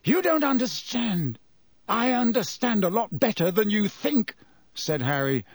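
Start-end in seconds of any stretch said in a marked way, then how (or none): 0.0-1.4 desperate, pressing warning; urgent pleading. A narrator's reading. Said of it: "a note of urgency in his voice". 1.9-4.3 trembling, barely held fury; shaky voice. A narrator's reading. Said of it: "his voice shook more than ever".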